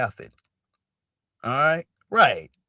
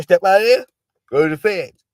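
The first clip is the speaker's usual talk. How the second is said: loud, higher command; louder mock judge repeat; mock judge order; 5 semitones higher, 8 dB louder than usual. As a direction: shout him off to the feds. Acting judge ordering Chauvin out again.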